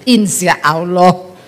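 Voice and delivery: low, slow mock-pious assent; imitated husbands' reluctant answer; men's doubtful reply. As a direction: drag it out low, unconvincingly. Mimics the men's dubious answer.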